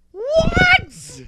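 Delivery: very high, loud indignant yelp; outraged squeak. Retold or imitated. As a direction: shriek of disbelief. Friend outraged there's no KFC.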